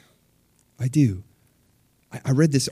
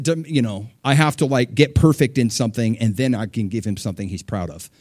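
ordinary talk

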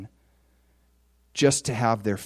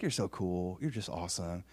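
low, soft, offhand praise; understated stranger's praise; casual compliment; 7 semitones lower, 12 dB quieter than usual. Retold or imitated. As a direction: toss off the compliment quietly. Stranger's passing compliment, acted.

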